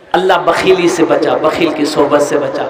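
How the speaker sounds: low-pitched, rolling supplication; murmured-register dua, well below preaching pitch; prayer. Real, not acted. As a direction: recite a blessing low and steadily for the donor. Short prayer against miserliness.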